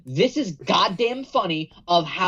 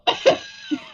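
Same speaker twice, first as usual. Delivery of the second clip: breathy laugh. Real, not acted. Laughing at dad's call.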